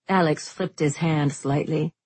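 usual speaking voice

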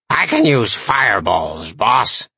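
croaky little demon servant; raspy imp voice. A narrator's reading. Demon servant answering the boss, raspy croak. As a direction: rasp it out as an eager-to-serve imp. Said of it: "croaked in its raspy, high-pitched voice".

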